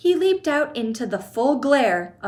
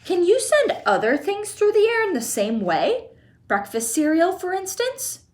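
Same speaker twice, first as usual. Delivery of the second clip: put-on high, eager boy's voice; excited question; 9 semitones higher than usual. A narrator's reading. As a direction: ask it bursting with excitement. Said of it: "he shouted".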